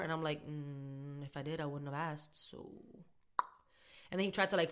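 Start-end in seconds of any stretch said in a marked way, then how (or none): 0.5-2.7 low, hushed, unimpressed retort; quiet, dry put-down; deadpan comeback. Retold or imitated. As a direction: say it under your voice, drawn-out "mm" first. Acts out her unimpressed comeback.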